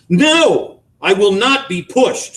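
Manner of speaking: high, loud and hard; firm refusal. A narrator's reading. Wife refusing husband, loud and firm. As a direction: plant your feet.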